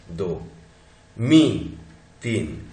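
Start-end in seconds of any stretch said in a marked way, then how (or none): 1.2-1.8 मी said alone, vowel held; isolated syllable. Shown as a demonstration. Teacher counting syllables for students, isolated demonstration. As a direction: sound out मी by itself. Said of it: "हमारे पास syllable कितने हैं?".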